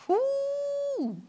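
high, drawn-out whoo; soft falsetto whoop of awe. Real, not acted. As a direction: let out a long, high whoo. Reacts to the fierce fury verse.

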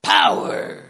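deep, growled, forceful POWER; drawn-out, loud POWER from the chest; like you mean it. Shown as a demonstration. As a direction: boom out the word with full conviction. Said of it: "say it like you mean it".

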